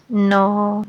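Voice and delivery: usual speaking voice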